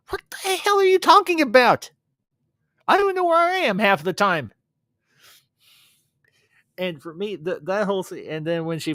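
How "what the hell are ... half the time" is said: loud, high-pitched exclamation of disbelief; hammed-up baffled yelling; acted outraged outburst. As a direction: burst out in mock exasperation, high and loud. Acts out Jay's baffled outburst.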